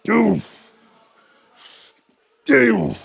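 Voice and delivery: loud, high coach yell; shouting rep count. Real, not acted. Counting reps, yelling.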